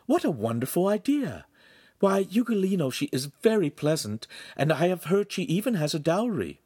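high-pitched gushing approval; bright, eager young woman. A narrator's reading. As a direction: enthuse over a marriage match. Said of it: "Giulietta said brightly".

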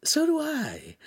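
very high, confiding admission; squeaky-high character confession. A narrator's reading. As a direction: own up to it in a very high voice. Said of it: "confided Pan".

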